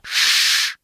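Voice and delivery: sharp whispered shush. Acted. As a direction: hush them with a hiss. Casey silences them.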